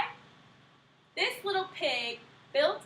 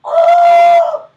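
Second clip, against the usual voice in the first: loud, high shocked laughing cry; shocked shout; 11 semitones higher, 19 dB louder, much slower than usual. A narrator's reading. Storyteller revealing the wolf ate the pig, shocked shout. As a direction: burst out in mock horror.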